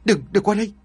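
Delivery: high, strangled cry of terror; a cruel master cornered by vengeful ghosts, terrified; terrified stammer. Acted. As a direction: plead with the ghosts, voice leaping up. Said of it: "Ông Phiên ú ớ".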